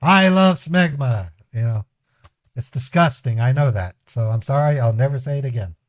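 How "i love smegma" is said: higher, louder, slow mock scammer line; a lightly voiced scammer, higher and slow; imitating scammers. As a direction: ham up the scammer saying it proudly. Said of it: "P C eight scammers saying".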